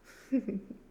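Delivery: gloating cackle with a falling tail; evil cackle. Acted. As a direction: cackle as the trap closes. Devil gloats over entranced victim.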